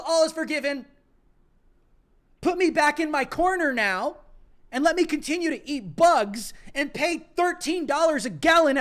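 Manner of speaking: loud, high fake celebration, dripping with sarcasm; sarcastic mock joy. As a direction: cheer it out like a mocking fan. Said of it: "my monotone sarcasm".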